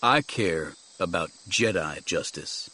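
low, controlled, grave voice; resolute Jedi master. Acted. Jedi rejecting jungle justice to companion, gravely resolute. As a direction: state it low, with quiet resolve.

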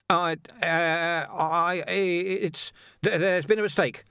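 high, faltering excuse; murmured stammer. A narrator's reading. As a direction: stammer an apology while backing off. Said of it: "Vimes murmured".

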